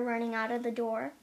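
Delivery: plain reading voice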